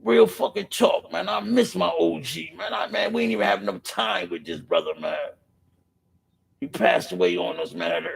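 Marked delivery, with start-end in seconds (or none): none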